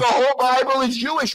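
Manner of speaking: heated shout. Real, not acted. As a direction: shout him down on it. Heated argument over religion.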